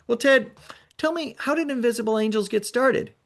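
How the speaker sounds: a hint of a stiff official; lightly voiced inspector. Voicing the safety inspector.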